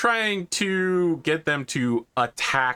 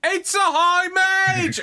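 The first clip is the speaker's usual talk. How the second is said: panicked shriek; 5 dB louder than usual. Acted. Guard shrieks at shot.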